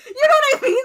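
high, loud laughing through words; laughing hard. Real, not acted. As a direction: laugh while explaining. Laughing at beetle boy.

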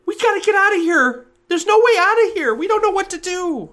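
put-on trapped child panicking; panicked kid. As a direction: blurt it out in panic.

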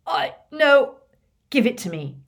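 flustered, choking demand; spluttering protest. A narrator's reading. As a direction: protest while held by the throat. Choking thief protests while pinned.

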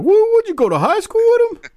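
ribbing a friend who forgot a wrestler's name, in squeaky mock disbelief; mock incredulous. Teasing a buddy about a forgotten name, high and incredulous. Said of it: "And I'm like".

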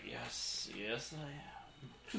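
very low, soft, self-satisfied dragon; puffed-up dragon. Acted. Puffed-up dragon accepting a fan's praise, smugly. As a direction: preen slowly in a deep hushed voice. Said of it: "Dragon's still puffed up at this".

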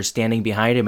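ordinary talk

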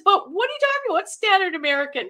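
high-pitched mock confusion; exaggerated puzzled question, starting very high; retold bafflement. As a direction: protest in baffled disbelief, acting out the memory. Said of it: "I says".

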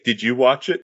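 ordinary talk

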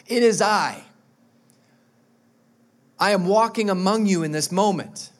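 loud, ringing proclamation; proclaiming as Jesus. Acted. Preacher voicing Jesus, proclaiming loudly. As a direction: declare it to the crowd. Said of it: "he's saying".

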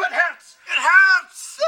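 hammed-up tearful wail; half-crying, half-laughing cry of hurt; overcome with emotion. Father sobs to his son after their reconciliation, overcome with emotion. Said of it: "I get so emotional".

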